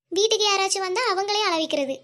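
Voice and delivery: ordinary delivery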